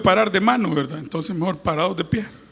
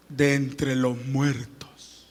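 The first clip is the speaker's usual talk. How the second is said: 1 slow hushed close; 8 semitones lower, 7 dB quieter, much slower than usual. Acted. Drops at the end of the verse.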